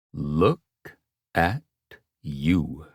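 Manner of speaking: Look, at, you each cut off hard; hard word endings. A narrator's reading. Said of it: "punctuated each word with a hard ending".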